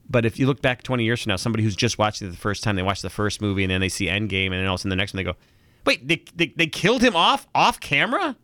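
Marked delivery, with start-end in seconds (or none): 5.8-8.4 higher-pitched, stumbling incredulous question; baffled disbelief. Acted. Host acting a future viewer of the film, baffled disbelief. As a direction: climb in pitch as the shock sinks in.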